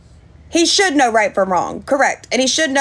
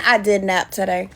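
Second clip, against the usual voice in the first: ordinary talk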